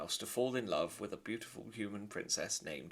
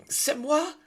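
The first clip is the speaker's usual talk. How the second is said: put-on very high, loud French-accented mother; haughty French lady; French mother mouse; 12 dB louder than usual. Antoinette answering her husband, high French haughty.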